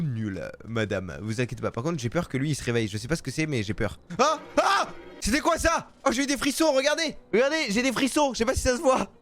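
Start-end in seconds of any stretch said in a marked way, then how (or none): 4.2-4.9 two short yelps of fright; startled cries. Real, not acted. Player frightened by waking figure, yelping. Jump scare in game. 5.2-9.1 high, excited aftershock of a scare; rattled fright. Real, not acted. Player showing goosebumps after a scare, rattled.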